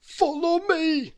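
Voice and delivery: Mister Greedy, shrugging off a cake, in a high slow voice; greedy character voice. Acted.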